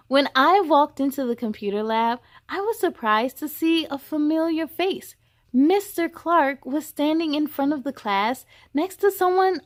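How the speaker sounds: put-on expressive children's story reading; story narration. A narrator's reading. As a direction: read it to a room of kids. Reads a children's book aloud.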